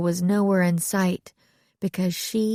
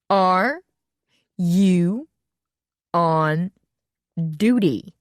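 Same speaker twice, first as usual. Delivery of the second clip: "Are you on duty?" each word split by long pauses, louder; one word at a time; 3 dB louder, much slower than usual. A narrator's reading. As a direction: spell it out as to a fool. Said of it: "she repeated one word at a time".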